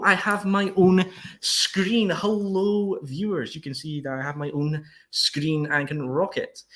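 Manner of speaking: slow, hammed-up promo voice; wrestling promo. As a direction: play to the camera like a wrestler. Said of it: "You want me to cut a promo".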